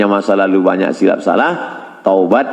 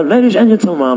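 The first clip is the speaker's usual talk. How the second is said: flight attendant; 7 semitones higher than usual. Retold or imitated. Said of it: "suara-suara pramugari itu".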